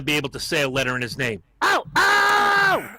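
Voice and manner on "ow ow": high loud yelp; mock pained yelp. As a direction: cry out like you've been hit. Reacting to the harsh comment.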